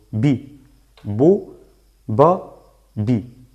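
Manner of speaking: "bi, bu, ba, bi", each vowel cut short. Shown as a demonstration. Teacher drilling short vowels, "bi, bu, ba, bi" very short. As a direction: separate short syllables "bi, bu, ba, bi". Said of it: "una pronunciación muy corta".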